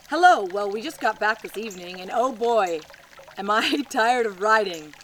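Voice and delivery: hammed-up greeting. Reading a 1949 postcard to viewers, cheery mock greeting. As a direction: belt out the hello like a cheery letter writer.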